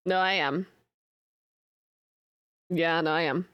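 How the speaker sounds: ordinary delivery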